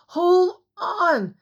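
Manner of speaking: high, cracking cry; croaked. A narrator's reading. Jack croaking down to Anne, voice cracking. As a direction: voice breaking with strain. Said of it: "Jack croaked".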